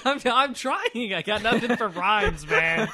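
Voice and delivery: words broken by laughter; laughing. Real, not acted. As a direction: laugh through the excuse. Laughing at own failure.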